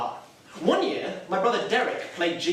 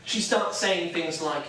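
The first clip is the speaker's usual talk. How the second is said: normal talking voice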